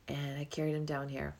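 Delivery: ordinary delivery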